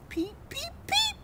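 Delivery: very high little peeps; frog peep. Acted. The frog diving into the mud, high peeps. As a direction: squeak it out like a tiny frog. Frog's call in story.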